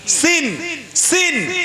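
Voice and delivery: সিন said sharply high and clear; demonstrating the letter. Shown as a demonstration. Preacher drills the letter সিন for listeners, high and clear. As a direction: pronounce সিন with a crisp s. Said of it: "এখানে কি?".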